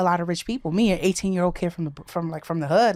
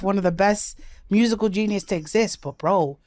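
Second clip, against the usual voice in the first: ordinary delivery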